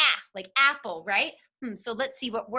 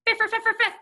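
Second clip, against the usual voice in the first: quick, higher-pitched tongue-twister burst; fast tongue-twister; 10 semitones higher, 7 dB louder, faster than usual. Shown as a demonstration. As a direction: rattle it off as a playful challenge. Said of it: "Can you guys say that fast?".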